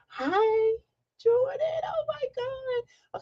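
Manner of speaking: high, slow delighted cry; real excitement; excited squeal. Real, not acted. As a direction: gush over a beloved viewer. Greeting a viewer in live chat.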